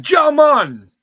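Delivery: loud, high Jamaican accent. Shown as a demonstration. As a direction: belt it out in a mock Jamaican accent. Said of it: "I can only do the fucking".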